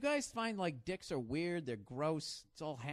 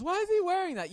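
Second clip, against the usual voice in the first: high, louder mock girl voice; girl's question; 13 semitones higher, 7 dB louder than usual. Women at the bar asking about the coat, high mock voice. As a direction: ask it like a puzzled woman at the bar. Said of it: "if the girl's".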